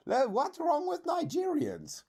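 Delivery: high, indignant question; squeaky, defensive challenge. Real, not acted. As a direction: voice leaps high in protest. Challenges viewer's remark on Nigerians.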